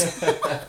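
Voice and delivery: a quick laugh at her own story; short real laugh. Real, not acted. Telling a friend, a short laugh. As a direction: laughs at herself mid-story. Laugh after retold question.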